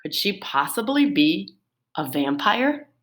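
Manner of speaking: ordinary talk, nothing marked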